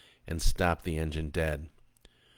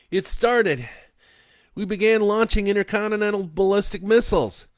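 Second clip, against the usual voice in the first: tense; 8 dB louder than usual. A narrator's reading. Husband answers in alarm.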